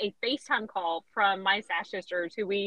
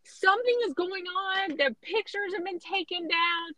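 higher, hushed urgent gossip voice; excited friends; 8 semitones higher than usual. Retold or imitated. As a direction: pass on the rumor breathlessly. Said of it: "And they're saying".

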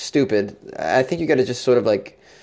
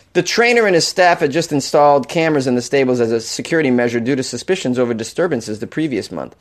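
ordinary delivery

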